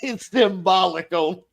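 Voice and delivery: smug corporate brush-off; lightly voiced smug boss. Game master as the NPC boss refusing scrap.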